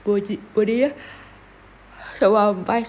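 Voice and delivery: drowsy, half-asleep words; sleepy and slurring a little; nodding off. Real, not acted. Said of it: "sorry ngantuk".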